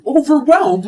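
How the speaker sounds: indignant echo. Acted. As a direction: fling his word back at him.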